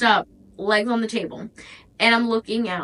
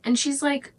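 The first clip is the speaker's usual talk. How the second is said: ordinary talk, nothing marked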